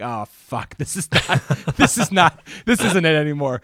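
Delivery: loud laughing mock panic over a slipping accent. Actor panicking on stage as the Irish accent slips, loud and laughing. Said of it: "and I'm like".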